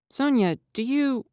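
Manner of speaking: high, slow, hesitant; shy boy voice, tentative; tentative Jack. A narrator's reading. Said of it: "Jack asks tentatively".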